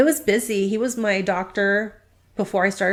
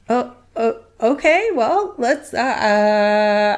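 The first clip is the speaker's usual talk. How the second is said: halting, higher-pitched fumbling; retold flustered stalling; 3 semitones higher, slower than usual. Practice manager absorbs the surprise hire, flustered. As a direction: imitate someone scrambling for an answer.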